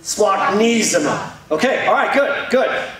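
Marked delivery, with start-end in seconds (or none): 0.0-1.3 Splachnisma said very slowly as splak-NEE-zma; slow Greek demo. Shown as a demonstration. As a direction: lead the room through Splachnisma, dragging each syllable out. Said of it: "We'll do it one more time".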